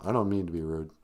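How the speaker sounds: usual speaking voice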